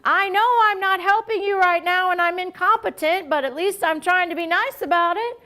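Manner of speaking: put-on high, whiny-kid style plea; high-pitched mock helplessness; childlike pleading. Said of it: "that's a little bit like".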